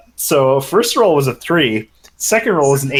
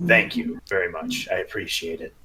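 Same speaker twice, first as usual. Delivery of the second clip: soft thanks; 6 semitones lower, 8 dB quieter than usual. Acted. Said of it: "Ravon turns to the guards and says".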